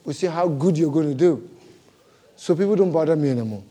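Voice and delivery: ordinary talk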